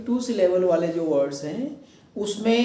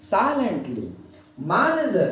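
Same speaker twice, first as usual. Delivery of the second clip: "Silently" and "manager" each stressed on the first syllable, then falling; SAI-lent-ly, MAN-uh-jer, pause between; slower than usual. Shown as a demonstration. Teacher recapping the rule by example, first syllables stressed. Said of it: "like this".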